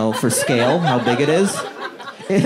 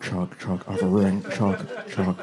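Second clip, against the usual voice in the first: low, much softer, mumbling Shaq voice; Shaq impression; 7 semitones lower, 8 dB quieter than usual. Comedian imitates Shaq talking to Chuck, low soft mumble. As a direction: murmur it low and soft, words blurring together. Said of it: "deep voice speaking Mandarin".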